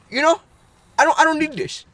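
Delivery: high, loud mock Indian accent; hammed-up indignant Indian-accented shop owner; imitated Indian accent. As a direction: protest proudly in a put-on Indian accent. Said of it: "he has an accent".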